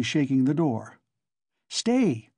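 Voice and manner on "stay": much higher warning cry; sharp cry. A narrator's reading. Young man warning Coke off the door, alarmed. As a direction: stop him with one word. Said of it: "cried the young man again".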